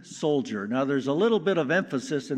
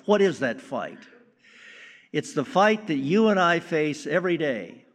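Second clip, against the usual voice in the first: usual speaking voice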